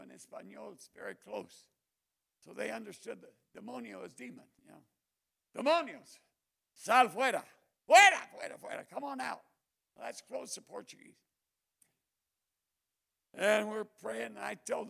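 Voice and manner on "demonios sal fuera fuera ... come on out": loud rising command to the demons; commanding shout. Retold or imitated. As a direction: bark the exorcism command, pitch climbing.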